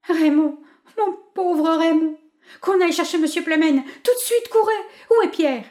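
high, loud, choked cries of panic; strangled voice. A narrator's reading. Wife finding her husband dead, choked high cries. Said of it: "d'une voix étranglée".

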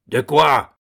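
a sudden loud yell. A narrator's reading. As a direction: bark it out in outrage. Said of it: "hurla soudain Doublenerre". (hurlement soudain)

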